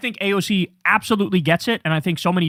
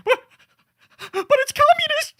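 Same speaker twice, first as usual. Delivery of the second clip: squeaky high mock voice, pitched way up; cartoonish high whine of fake alarm; mocking opponents; 3 dB louder than usual. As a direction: parody a panicked pundit crying communist. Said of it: "where they say".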